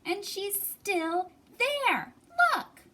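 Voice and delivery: high, slow, whiny little bear; grumbling baby bear. Acted. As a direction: drag it out like a sulky cub. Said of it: "grumbled Baby Bear".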